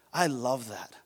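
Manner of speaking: ordinary talk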